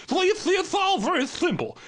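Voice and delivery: soaring pundit shout; high shout. Acted. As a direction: belt it out high. Pundit rant peaks.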